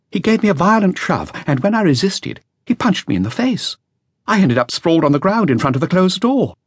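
lightly voiced nervous young man; young man's voice. A narrator's reading. Flautist continues his account.

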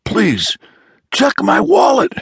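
high, loud begging; desperate pleading. A narrator's reading. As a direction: plead in panic. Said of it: "he cried".

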